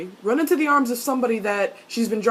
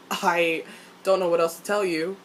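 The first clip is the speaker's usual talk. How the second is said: ordinary talk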